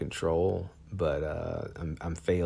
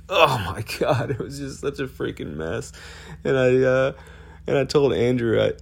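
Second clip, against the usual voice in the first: real tearful, high voice; 7 semitones higher, 6 dB louder than usual. Real, not acted. Recalling mourning his own death, tearful and high. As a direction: voice pitched up and strained with real tears. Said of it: "started to mourn for my own death".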